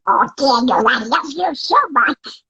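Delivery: high squawky duck voice, slow and loving; mom in Donald Duck voice. Retold or imitated. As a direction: tuck a child in, quacking tenderly. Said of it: "who taught me the voice".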